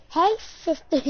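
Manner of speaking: high, loud mock vlogger greeting; James Charles. Greeting the sisters, playful mock vlogger voice. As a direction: greet fans in a hammed-up high voice. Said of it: "no, J-, James Charles".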